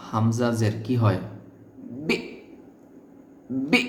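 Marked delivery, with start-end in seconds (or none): 2.1-3.8 বি cut short with a high, hard catch; abruptly stopped hamza. Shown as a demonstration. Teacher shows learners how to hold the sound, abruptly stopped hamza. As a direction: catch the sound of বি suddenly in the throat. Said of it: "আওয়াজটাকে আটকে ধরতে হবে একটু hardly".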